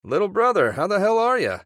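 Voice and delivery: big, high, hearty hello; genuine brotherly greeting. Acted. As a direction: boom out a delighted welcome. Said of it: "Brian asked genuinely".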